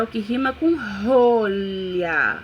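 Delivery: slow card read, what rhymes with rolha. Shown as a demonstration. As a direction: read it like a prompt to a child, slowly. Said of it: "o card tá falando".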